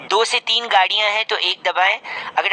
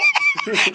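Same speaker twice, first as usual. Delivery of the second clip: high laugh. Real, not acted. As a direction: burst of high-pitched laughter. Prank caller laughs.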